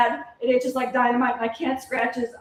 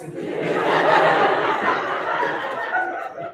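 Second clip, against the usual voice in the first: breathless unvoiced giggle. Laughing at being rickrolled.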